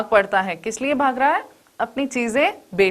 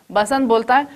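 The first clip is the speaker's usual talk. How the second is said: ordinary delivery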